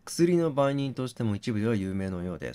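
normal talking voice

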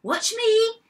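high, loud, excited call; gleeful little boast; eager showing off. A narrator's reading. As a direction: call out for everyone's attention. Goat showing off on the slide.

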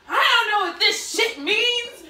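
high, loud, drawn-out mock complaint; imitating exasperated mother. Daughter retells mom puzzling over test instructions, mock exasperation. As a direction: act out mom baffled, slow and loud. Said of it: "she's going like this".

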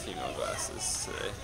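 whispering. Real, not acted. Whispered aside about glasses.

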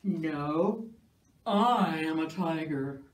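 low, slow, growly insisting; deep tiger voice. Acted. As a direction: puff yourself up as a big tiger.

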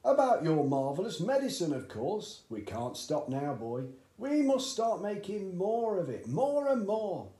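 high, loud, eager father; eager dad. A narrator's reading. Excited father urging his son at breakfast, high and loud. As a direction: push the boy on, thrilled with the idea.

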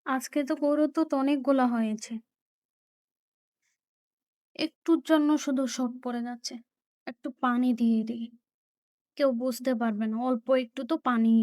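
scheming under her breath; secretive murmur. Acted. Mother secretly plans watering milk.

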